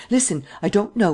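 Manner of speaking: listen lifted high and urgent; eager. A narrator's reading. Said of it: "said she eagerly".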